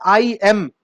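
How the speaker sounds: "आई एम" with a drawn "aai"; a hint of a mispronounced "आई एम"; mock wrong I. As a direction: imitate people saying "आई एम". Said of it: "लोगों को बोलते हुए भी सुना है".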